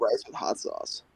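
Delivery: softly mumbled, half swallowed "but rice with hot sauce". Real, not acted. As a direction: say "but rice with hot sauce" under your breath, almost unheard. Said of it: "What'd you say?".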